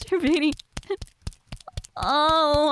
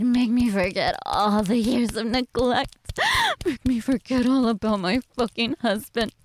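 ordinary delivery

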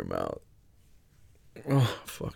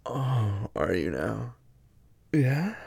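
ordinary delivery

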